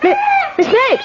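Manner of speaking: high calling cry up the stairs; frantic calling. Acted. Landlady calling up the stairs for her lodger, frantic. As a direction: call up to her, panicking.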